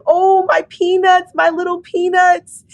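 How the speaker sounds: high, cooing imitation of mom; delighted doting mother. Mother greeting her pregnant daughter's belly, delighted high cooing. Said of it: "she's laughing at me".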